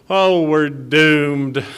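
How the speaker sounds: put-on small child voice. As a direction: drag out the doom the way a toddler would. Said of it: "little Luke is his name".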